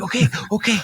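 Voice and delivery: high, mock-frightened yielding; flinching. Man being walked out by police, flinching. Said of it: "he's, like, flinching down".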